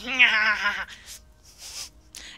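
hammed-up villain cackle; evil laugh. After scaring the runaway slave, a mock evil laugh. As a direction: laugh like a cartoon baddie.

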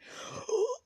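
high, loud mock gasp; shocked gasp. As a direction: gasp in exaggerated outrage. Figure is shocked by insult.

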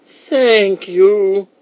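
put-on high, loud quavering old lady voice; high-pitched old-woman character voice. A narrator's reading.

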